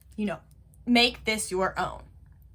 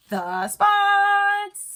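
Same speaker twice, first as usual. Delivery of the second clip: mock surprise; 8 semitones higher than usual. Suddenly remembers the spots.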